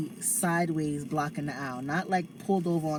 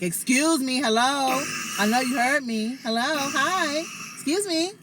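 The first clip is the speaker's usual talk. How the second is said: louder, higher "Excuse me, hello"; loud fed-up; 8 semitones higher, 4 dB louder than usual. Retold or imitated. To shoppers who won't move their cart, loud and fed up. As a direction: call out, exasperated at the ignorer. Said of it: "that's when I'll be like".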